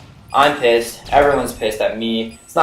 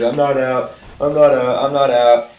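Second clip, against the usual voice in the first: mock stubborn protest; 3 semitones higher, 5 dB louder than usual. Kid stubbornly refuses to go out.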